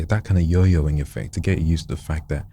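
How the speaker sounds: ordinary talk, nothing marked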